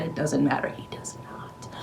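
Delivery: whispered snarky aside; mock whisper. Vlogger answering her own question in a whisper. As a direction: hush it as a sneaky aside.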